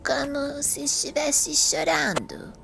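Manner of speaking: put-on low, slow old woman's voice, hushed at times; an elderly lady recalling the statue, slowly; lightly voiced old woman, unhurried and low; frail old lady. Old Mrs Lee describes statue.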